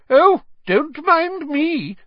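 high, slow, sarcastic portrait voice; irritable fat lady. A narrator's reading. The fat lady calling after the departing students, irritable and high. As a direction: call after them with injured sarcasm. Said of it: "the fat lady called irritably".

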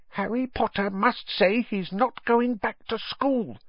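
high, squeaky little elf voice; small high creature making a demand; insistent house-elf. A narrator's reading. House-elf bargaining with Harry, insistent.

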